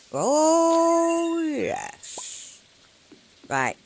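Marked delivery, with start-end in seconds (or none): 0.1-2.6 long, high, loud drawn-out oh then a breathed yes; real thrill at landing the squealy eel; triumphant oh yes. Real, not acted. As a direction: stretch the oh in delight, then whisper yes. Caught the eel.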